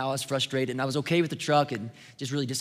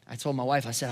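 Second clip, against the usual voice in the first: ordinary delivery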